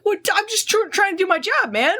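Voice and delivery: high-pitched, put-upon mock complaint; exasperated protest. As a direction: voice shoots up, imitating a harassed worker. Death complained at by sisters.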